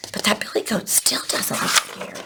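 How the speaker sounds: hushed surprised aside; whispering. Real, not acted. Aside to listeners.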